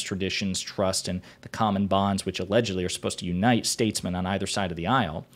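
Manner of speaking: ordinary delivery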